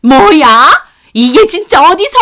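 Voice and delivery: high, very loud threatening shout; mother-in-law outraged at daughter-in-law's reply; threatening outburst. Acted. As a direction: explode at her, advancing. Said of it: "위협적으로 다가오시더라고요".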